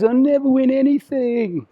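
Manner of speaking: high, drawn-out mock lament; mock self-pity. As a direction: wail it slowly like a hard-done-by loser. Voicing audience member's losing belief.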